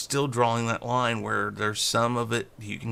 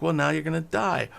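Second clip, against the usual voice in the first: smug gloat; 7 semitones higher than usual. Retold or imitated. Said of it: "has that smug smile and goes like".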